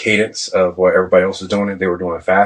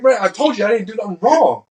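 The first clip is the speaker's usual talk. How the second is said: much higher-pitched indignant retelling; heated protest; 12 semitones higher than usual. Retold or imitated. Sailor arguing back at the senior chief, retold heated protest. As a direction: protest your innocence in a worked-up high voice.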